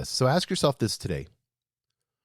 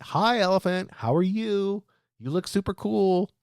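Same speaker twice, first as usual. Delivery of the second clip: higher-pitched mock-friendly hello; playful greeting; 6 semitones higher than usual. Leadership coach addressing the elephant in the room, playful greeting. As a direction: greet an imaginary guest in a high, chummy voice.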